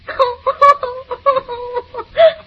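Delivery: high mock sobbing cackle; hammed-up sob-laugh. Wife rehearsing a scene, hammed-up sob-laugh. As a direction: fake a tearful laugh, way over the top. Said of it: "I'm gonna cry".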